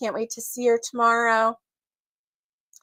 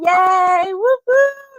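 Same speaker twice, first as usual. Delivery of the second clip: high, loud whoop of joy; a real gleeful whoop, much higher; excited cheer; 8 semitones higher, 7 dB louder, much slower than usual. Real, not acted. Cheering sister's safe arrival.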